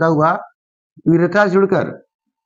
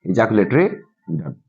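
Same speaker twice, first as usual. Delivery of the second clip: low-pitched ejaculatory, pause, short duct; lowered voice, ejaculatory and duct set apart; slow dictation; 8 semitones lower, 3 dB quieter, much slower than usual. Shown as a demonstration. Teacher dictates term once more.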